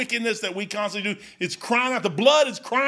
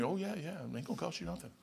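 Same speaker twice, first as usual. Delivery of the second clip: soft, hushed reassurance from the Lord; gently promising, much softer; quiet comforting voice; reassuring; 5 semitones lower, 16 dB quieter than usual. Retold or imitated. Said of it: "he assured me".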